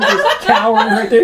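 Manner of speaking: high laughing narration; laughing while describing. Real, not acted. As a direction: talk through the giggles. DM laughing after kobold voice.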